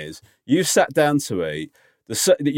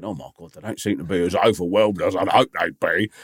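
low, hushed mock-worried aside; nervous inner thought; 7 semitones lower than usual. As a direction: imitate a host privately fretting. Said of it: "nervously looking around thinking".

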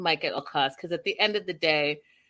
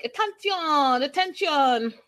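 higher, slow, drawn-out call for attention; mock announcement; 7 semitones higher, slower than usual. Retelling the suitor calling the room to attention, mock formal. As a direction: imitate him tapping a glass for the room. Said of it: "And so he's like".

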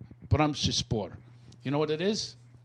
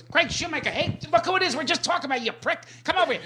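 high-pitched wise-guy impression; excitable high mobster voice; Joe Pesci; 11 semitones higher than usual. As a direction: imitate a mobster greeting a pal, pitched way up. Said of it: "and he goes".

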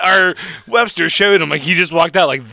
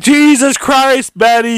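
loud, much higher, slow mock exasperation; exasperated onlooker; 10 semitones higher, 7 dB louder, slower than usual. Annoyed friend scolds Betty, mock loud and slow. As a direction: groan it out at Betty, hammed up. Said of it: "And, like".

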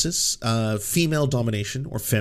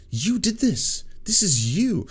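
pointed accusation; 8 semitones higher, slower than usual. Retold or imitated. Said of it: "and it's like".

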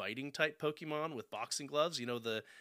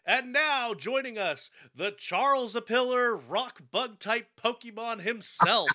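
wrestling ring announcer; 9 semitones higher, 8 dB louder than usual. Pretend. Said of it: "announce me like a wrestler entering the ring".

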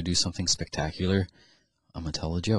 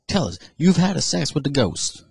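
high, raised mock-preacher voice; acted amazed pastor; 8 semitones higher, 5 dB louder than usual. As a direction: urge a confession in hammed-up wonder. Pastor urges man in a joke.

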